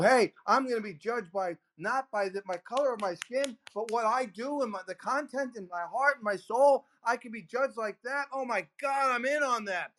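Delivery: inspired listener. Retold or imitated. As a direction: play the fired-up listener who buys in.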